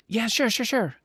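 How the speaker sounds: put-on high, eager little girl. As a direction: chirp it like an excited kid. Child character answers the door.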